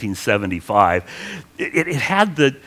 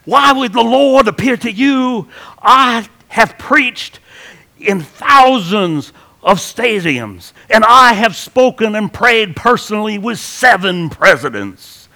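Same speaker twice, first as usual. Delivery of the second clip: loud, high Southern evangelist with stretched words; television preacher; 9 semitones higher, 7 dB louder than usual. Retold or imitated. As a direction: parody a pompous TV preacher boasting. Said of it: "you gotta have the accent".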